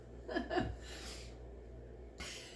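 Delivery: long breathy laugh. Real, not acted. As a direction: burst out laughing. Laughs at the reading.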